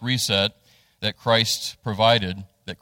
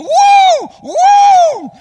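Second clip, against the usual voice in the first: loud, much higher whoops like a fan at the Super Bowl; ecstatic whooping; 12 dB louder than usual. Retold or imitated. Dad cheering the shuttle launch from a patio chair, wild whoops. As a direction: let it rip, whooping with joy. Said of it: "he starts jumping up and down".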